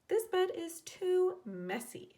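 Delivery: high, slow, fed-up sigh; light sighing complaint. A narrator's reading. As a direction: sigh it out, tired of the mess. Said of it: "she sighed".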